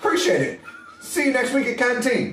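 mock Irish accent. Prisoner saying goodbye to his cellmate, in a mock Irish accent. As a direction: chuckle through a jokey Irish accent. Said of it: "Is that how they talk over there?".

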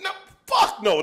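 high, scoffing no; mock refusal. Refusing Matt Damon at the bar, high mock scoff. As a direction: shoot him down laughing.

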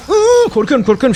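mock terror. Joking to his friend, a hammed-up terrified squeal. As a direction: fake a frightened squeal, then drop it as a joke. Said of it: "şaka".

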